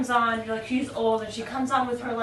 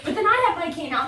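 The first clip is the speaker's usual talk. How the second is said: normal talking voice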